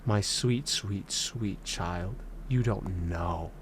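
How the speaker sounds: low, soft, patronizing imitation; condescending mock voice. Imagined viewer scolding Isaac in a low, soft patronizing voice. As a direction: talk down like a smug elder, deep and hushed. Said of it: "some people might be saying".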